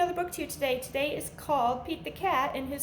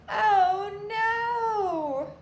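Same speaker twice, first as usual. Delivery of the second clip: louder mock "oh no!"; hammed-up storybook alarm; mock dismay; 9 semitones higher, 6 dB louder than usual. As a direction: gasp it like a storyteller feigning shock. Button lost.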